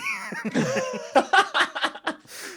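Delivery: very high loud burst of laughter; big laugh. Real, not acted. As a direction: crack up at the punchline. Laughing at the retold joke.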